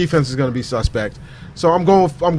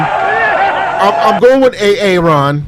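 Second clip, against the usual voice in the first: usual speaking voice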